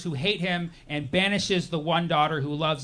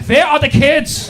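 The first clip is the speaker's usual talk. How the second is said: put-on slow, loud, high German-accented demand; 8 semitones higher, 9 dB louder than usual. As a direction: bark it like a German officer. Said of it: "German accents".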